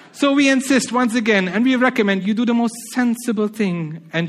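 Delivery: put-on Spanish lighthouse keeper coolly refusing the carrier, Spanish accent. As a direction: deliver the punchline in a smug Spanish accent. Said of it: "try to put on a Spanish accent".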